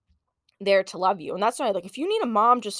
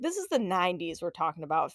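ordinary talk

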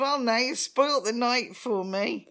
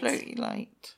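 whispered; 4 dB quieter, slower than usual. Pretend. Whispered echo.

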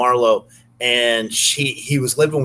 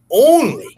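ONLY punched high and loud; emphatic; 12 semitones higher, 6 dB louder than usual. Retold or imitated. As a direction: hit Only hard to set up the punchline. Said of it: "I said".

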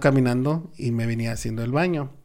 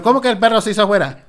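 mother questioning; 6 semitones higher, faster than usual. Retold or imitated. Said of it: "Y me dijo".

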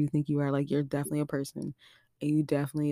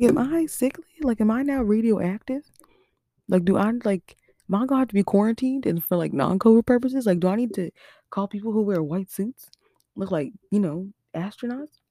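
high, louder anxious rambling; panicky questions; 6 semitones higher, 5 dB louder than usual. Retold or imitated. To the nurse, fearing she's radioactive, high anxious questioning. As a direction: spiral into worried what-ifs.

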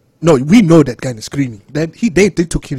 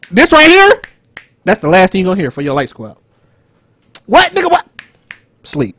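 hammed-up tough-guy threat, higher and louder; mock fight challenge; 4 semitones higher, 6 dB louder than usual. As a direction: pretend to square up. Acts squaring up for a fight.

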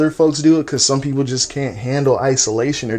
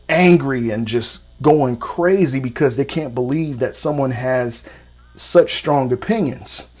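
ordinary talk

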